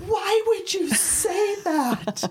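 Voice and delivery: imitating a horrified sister; hammed-up appalled question; appalled shock. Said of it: "you just were like".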